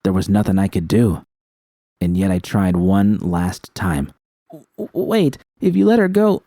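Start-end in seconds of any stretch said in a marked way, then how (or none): 4.5-6.4 stammering plea. A narrator's reading.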